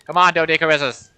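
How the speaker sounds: loud excited cheering; root for them loudly. Real, not acted. Cheering animals fighting.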